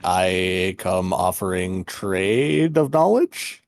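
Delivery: slow, low, formal delivery; ceremonious offer. Acted. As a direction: make a stately offer, drawing out the words. Player voices formal offer to door.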